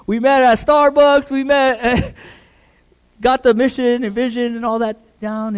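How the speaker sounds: high, loud, through real laughter; laughing recollection. Real, not acted. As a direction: tell it while cracking up. Laughing over a shared memory.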